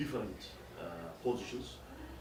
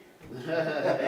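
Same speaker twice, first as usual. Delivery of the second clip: a short, bright burst of laughter; a hearty real laugh; 7 semitones higher, 8 dB louder than usual. Real, not acted. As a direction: laugh out loud at your own point. Amused at no Independence Day.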